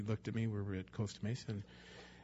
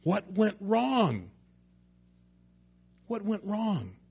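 anguished question; 8 semitones higher, 5 dB louder, slower than usual. Pretend. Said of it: "He's basically saying".

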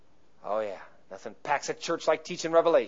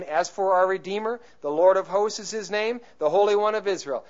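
higher, emphatic verse reading; emphatic scripture reading; 3 semitones higher than usual. Real, not acted. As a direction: read the verse out with rising emphasis.

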